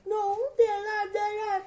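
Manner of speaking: high-pitched wailing denial; high, whiny mock protest. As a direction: imitate viewers wailing in disbelief. Sister and Kelly protesting the deaths.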